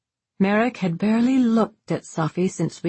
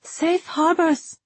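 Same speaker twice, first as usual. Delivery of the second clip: high, slow answering call; drawn-out call back with sounded Rs; 12 semitones higher, slower than usual. A narrator's reading. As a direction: return the farewell in a sustained high voice. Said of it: "Safi called back".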